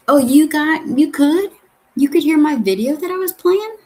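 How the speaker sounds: suddenly high and loud, caught off guard; real surprise, voice jumps up; surprised. Real, not acted. Learns viewers heard the video.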